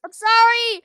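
loud, high sorry; loud apology. Real, not acted. Apologises after hitting someone.

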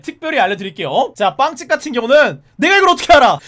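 normal talking voice

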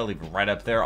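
ordinary talk, nothing marked

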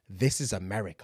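low, quiet matter-of-fact delivery; understated punchline. Acted. Centre's answer to the angry son, low and offhand. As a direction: drop it down low and soft. Acting the unapologetic answer, first take.

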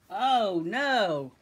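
loud exclamation of alarm; alarmed cry. A narrator's reading. Narrator hears the rumbling snow, loudly alarmed. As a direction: exclaim as danger looms. Avalanche rumbles toward them.

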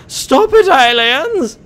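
very high, loud plea; panicked high-pitched yelling at aliens; desperate shriek. Real, not acted. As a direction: shriek it as they swarm you. Overwhelmed by alien attack.

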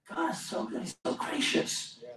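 hushed, breath-only praise; awed whisper. Real, not acted. Preacher marvelling at God's goodness, awed whisper. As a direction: whisper it in real awe. Preacher overcome with awe at God.

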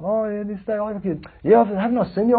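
butter them up like a smooth salesman; gushing salesman. Retold or imitated. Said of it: "they pump you up".

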